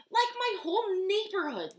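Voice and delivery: put-on eager kid. Lola answers eagerly.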